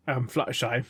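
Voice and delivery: ordinary talk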